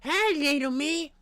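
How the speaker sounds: lightly voiced high, slow Carmen impression; a loose, playful take on Carmen. Streamer jokes to chat, doing a light Carmen impression. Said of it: "That's Carmen".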